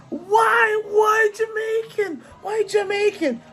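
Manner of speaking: mock incredulous wail. Friends mocking her news in a high slow wail. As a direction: whine it like a shocked friend who can't believe it. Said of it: "Everyone was like".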